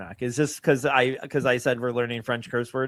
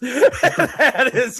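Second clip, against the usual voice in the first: laughing; 9 semitones higher, 6 dB louder than usual. Real, not acted. Laughing at the joke.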